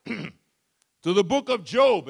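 usual speaking voice

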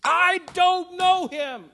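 slow, high, loud furious denial; cursing denial. Retold or imitated. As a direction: spit it out, cursing himself. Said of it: "called cursings down on himself".

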